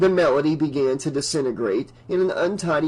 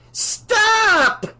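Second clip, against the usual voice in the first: very high, loud shriek; 6 dB louder than usual. Acted. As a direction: shriek it to stop them. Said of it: "she shrieked".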